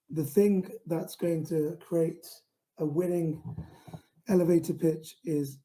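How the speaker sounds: ordinary delivery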